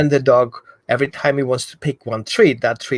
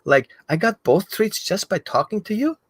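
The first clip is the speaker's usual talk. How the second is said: a hint of a higher, amazed dog voice; 7 semitones higher than usual. Dog surprised it got both treats, lightly higher amazed voice. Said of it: "And the dog is like".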